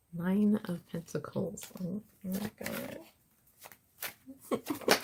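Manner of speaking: high, soft and breathy; breathless delight. Real, not acted. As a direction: gasp it out, thrilled. Breathless reaction to card.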